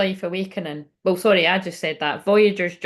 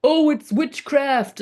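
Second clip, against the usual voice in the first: mocking; 7 semitones higher, 3 dB louder, much slower than usual. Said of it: "there's a rise in people like".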